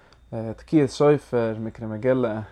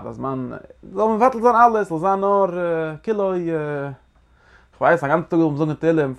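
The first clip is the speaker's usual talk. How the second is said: higher and louder, swept up in the sermon; fervent preaching; 5 semitones higher, 5 dB louder than usual. Acted. Rabbi urging listeners about the seder night, fervent preaching. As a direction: preach it out, climbing with fervour.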